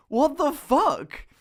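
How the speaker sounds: high, loud, drawn-out shocked disbelief. Retold or imitated. Student reacting to the lecturer knowing him, shocked. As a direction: squeal it in total disbelief.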